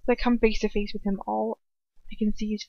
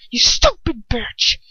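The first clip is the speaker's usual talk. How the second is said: slow, louder spat insult; hiss; 3 semitones higher, 6 dB louder, slower than usual. A narrator's reading. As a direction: spit the insult at her. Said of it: "he hisses".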